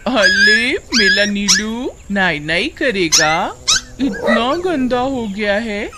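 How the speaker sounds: slow, cooing pet talk; baby talk. Acted. Suman bathing her dog, cooing baby talk. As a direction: coo to a pet with childish lisped words.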